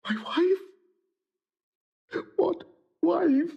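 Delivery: each word climbing in shock; stunned disbelief. Acted. Othello reeling at his own words.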